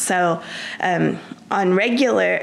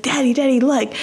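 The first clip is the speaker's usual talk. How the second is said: mock child voice, eager and higher; calling out to Daddy in a lightly voiced child's eagerness; excited child; 6 semitones higher, 3 dB louder than usual. Little boy excitedly calls his daddy to look, lightly imitated and eager.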